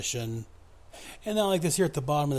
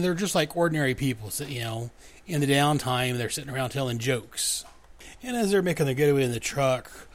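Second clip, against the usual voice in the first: ordinary talk, nothing marked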